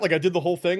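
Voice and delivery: usual speaking voice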